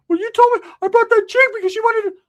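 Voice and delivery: high, loud cartoonish cousin voice; cousin Ricky voice. Cousin Ricky pulling him aside, in a high squeaky voice.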